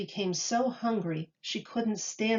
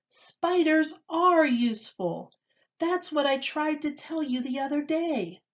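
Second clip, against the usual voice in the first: excited discovery; 7 semitones higher than usual. A narrator's reading. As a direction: proudly explain the discovery. Joe explains his discovery.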